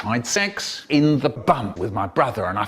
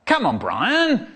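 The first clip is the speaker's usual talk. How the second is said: a bit cross; 8 semitones higher, slower than usual. Retold or imitated. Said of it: "getting a bit cross".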